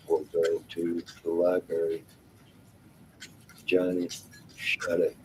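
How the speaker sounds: normal talking voice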